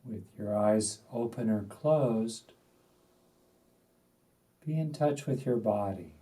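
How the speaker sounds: unhurried, spacious delivery, letting each instruction settle; guided meditation. Acted. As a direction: guide them gently into stillness, phrase by phrase.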